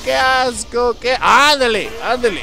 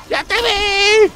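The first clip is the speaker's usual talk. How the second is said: teasing taunt; 10 semitones higher, 4 dB louder, much slower than usual. Real, not acted. Taunts teammate Winston, drawn out.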